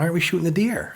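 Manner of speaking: put-on high, loud kid voice; excited kid. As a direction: ask it like an impatient little boy.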